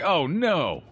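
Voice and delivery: dismayed exclamation. Real, not acted. Stacking fails.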